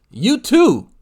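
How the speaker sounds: high, loud mock proclamation; hammed-up, high-pitched call to the crowd; mock sales pitch. Streamer mocking G-Man's gospel, hammed-up pitch.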